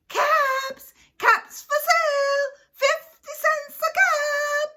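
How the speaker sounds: high, loud street-seller's cry; peddler's call. Acted. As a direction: call out your wares across a village. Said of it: "he walked back to the village calling".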